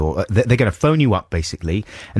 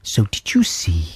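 whispering. Real, not acted. New topic teaser.

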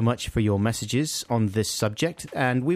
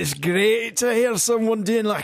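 mock Scottish accent; 8 semitones higher than usual. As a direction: put on a hammy, high-pitched Scottish brogue. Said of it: "like, a proper Scottish accent".

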